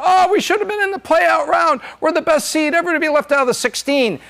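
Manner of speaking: high-pitched mock whining protest; mock complaint. Acts out snubbed team's complaint.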